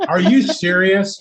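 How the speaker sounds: high, drawn-out incredulous question; mock disbelief. Installer reacting to customers showering before he arrives, incredulous.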